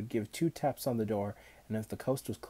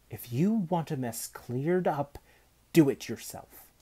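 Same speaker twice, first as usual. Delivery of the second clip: firm retort; 5 semitones higher, 5 dB louder than usual. A narrator's reading. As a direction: hand the job back firmly, louder at the end. Cook defies Manfred.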